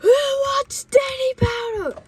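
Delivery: high-pitched, stretched-out holler; yelling. Retold or imitated. Kid announcing to the whole bus, loud high yell. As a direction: yell it like there's no intercom.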